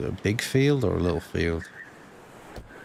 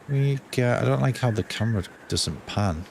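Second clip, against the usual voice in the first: ordinary talk, nothing marked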